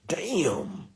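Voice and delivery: breathy hushed damn; whispered cuss. Retold or imitated. Kid trying out a swear word, whispered.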